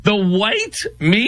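high, questioning echo of the phrase; mock disbelief. Host echoing the congresswoman's phrase back, mock incredulous.

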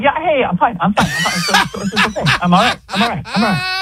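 high, jittery mock reassurance; nervous guy. Mocking the host as a nervous man saying he's fine. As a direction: play a twitchy guy insisting he's fine. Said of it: "it sounded like someone who's, like, kind of scratching their neck nervously".

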